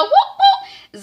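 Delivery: high squealed whoop whoop; playful high cheer; mock cheer. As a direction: cheer it like a proud aunt. Cheering the debutante's GPA.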